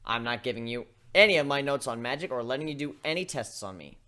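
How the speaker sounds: high, loud, flat-out no; lightly voiced brash griffin; stubborn griffin refusal. Gilda refusing Twilight's tests, loud and stubborn.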